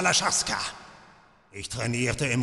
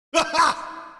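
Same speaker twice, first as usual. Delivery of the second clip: sadistic laugh. Acted. Torturer laughs at his captive.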